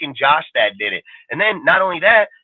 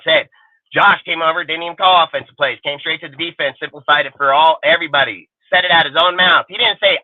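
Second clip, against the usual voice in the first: ordinary talk, nothing marked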